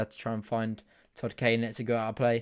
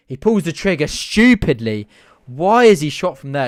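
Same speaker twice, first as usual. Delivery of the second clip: exasperated outburst; 3 semitones higher, 9 dB louder than usual. Real, not acted. Fan raging at a player's pointless long shot, loud. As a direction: despair at the wasted chance.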